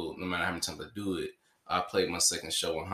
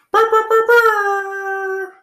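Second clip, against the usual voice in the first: very high loud trilling burr; host trumpeting the co-host reveal, high and loud; mock fanfare trill; 15 dB louder, much slower than usual. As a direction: sound the fanfare with your voice.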